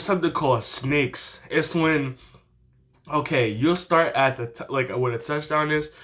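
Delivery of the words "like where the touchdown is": ordinary talk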